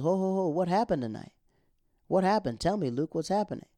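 high, lightly voiced worried mother; mock concerned mom. Mom asking Luke what happened tonight, mock concern. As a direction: imitate a mom urgently questioning her son.

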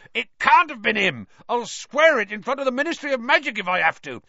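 loud, high, agitated rant; fast, frantic protesting; Hagrid, ranting. A narrator's reading. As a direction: rant on without letting anyone interrupt. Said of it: "Hagrid went ranting on".

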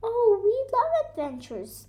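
excited storybook bear; enthusiastic. A narrator's reading. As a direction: gush with quick delight. Bear delighted by adventure.

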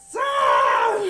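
high, very loud yell; hammed-up alarmed scream; acted panicked shout. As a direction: shout it to shut down the scare. Retold panic at seeing shadow.

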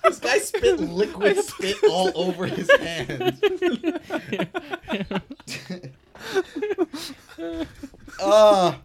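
high laughing, half shouting; laughing in disgust. Real, not acted. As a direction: laugh while recoiling. Friend spits everywhere.

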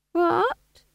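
put-on high feigned-innocent old woman; innocent old gossip. A narrator's reading. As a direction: play dumb, voice pitched high. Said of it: "she said".

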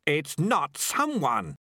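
emphatic irritated correction; hissed objection. A narrator's reading. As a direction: hiss the correction, word by word. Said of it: "he hissed".

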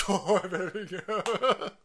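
ordinary delivery

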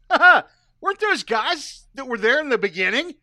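sudden realization. Retold or imitated. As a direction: burst out with a loud, high-pitched question. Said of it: "I went".

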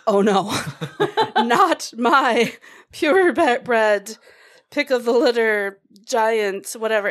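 loud, laughing mock protest; husband's protest. Wife retelling husband defending the dog, loud and laughing. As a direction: play the offended dog owner, loud. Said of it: "And my husband was like".

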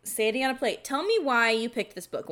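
usual speaking voice

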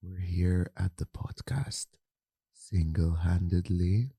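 slow, soft, breathy podcast greeting; ASMR voice. Pretend. [name] performing an ASMR intro on request, slow and soft. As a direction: ease into it like an ASMR video. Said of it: "let's hear it anyway".

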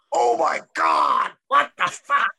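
slow, shocked outburst; stunned disbelief. Retold or imitated. Reacting to the woman's devotion, shocked exclamation.

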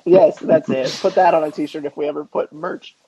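ordinary talk

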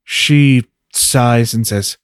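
ordinary talk

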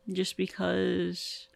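very slow, dragged-out words; drawn out. Shown as a demonstration. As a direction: stretch each word lazily. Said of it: "repeats phrase, drawn out first".

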